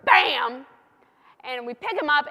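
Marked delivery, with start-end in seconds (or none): 0.0-0.7 loud punchy Bam; bird strikes. Retold or imitated. The bird smacking into Jesus with a loud Bam. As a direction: slam the word like a hawk hitting. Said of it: "The bird goes".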